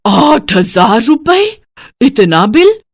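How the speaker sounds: gasp, then loud drawn-out disbelief; aghast, rising in horrified questions; shocked alarm. Acted. Stingy woman seeing the electricity bill, gasping in alarm. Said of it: "संतोष बिजली का bill देखते ही घबरा जाती है".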